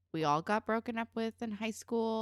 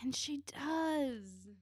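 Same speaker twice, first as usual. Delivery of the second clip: slow, drawn-out whisper; hushed aside; 5 semitones higher, 4 dB quieter, much slower than usual. Real, not acted. Co-host whispers a spoiler about the ruined day, hushed. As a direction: let it out slowly under the breath.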